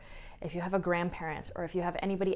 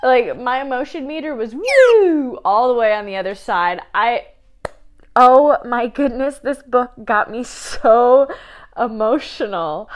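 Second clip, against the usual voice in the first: louder, tearful rush; emotional; 4 semitones higher, 12 dB louder than usual. Real, not acted. Vlogger overcome recalling the book, loud and tearful. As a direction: push through the tears. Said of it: "It's making me cry right now".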